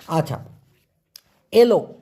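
ordinary delivery

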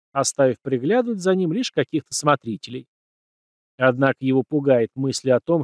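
usual speaking voice

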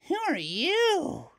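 put-on a scared girl squeaking up; squealed. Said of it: "Doris squealed".

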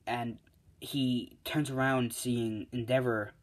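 plain reading voice

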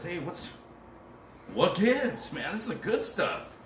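high-pitched, excited exclamation; amazed wedding master. Acted. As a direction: exclaim in surprised delight, pitch leaping up.

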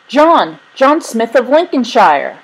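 loud, high call across a crowd; yelling. A narrator's reading. Father spotting his lost son near the ticket sellers, yelling. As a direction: yell out the name, voice lifted. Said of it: "a familiar voice yelling".

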